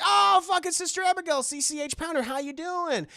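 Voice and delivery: overly sweet phone hello; fake cheery mom. Mother answering phone cheerfully.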